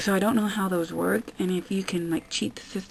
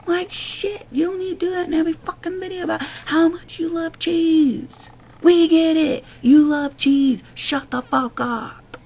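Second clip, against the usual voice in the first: fed-up rant; 6 semitones higher, 4 dB louder than usual. Real, not acted. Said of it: "fed-up rant".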